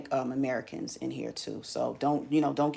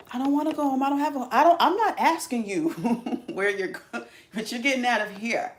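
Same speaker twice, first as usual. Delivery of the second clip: high, louder, laughing scold; mock eviction rant; 9 semitones higher, 4 dB louder than usual. Evicting hotel guests, high laughing scold. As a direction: shoo them out half joking.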